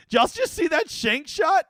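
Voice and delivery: high, slow, amazed call-out to chat; incredulous streamer shout-out; excited disbelief. Real, not acted. Streamer reacts to a bad shot.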